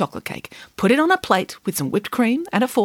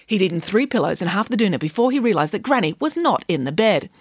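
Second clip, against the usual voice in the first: ordinary delivery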